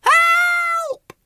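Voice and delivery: very high squealed call; piercing little wail; cry for help. A narrator's reading. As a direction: squeal for rescue. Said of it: "called Whimsy".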